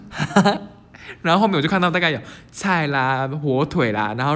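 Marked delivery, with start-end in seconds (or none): 0.1-0.6 laughing huh. Retold or imitated. Confused, laughing at memory.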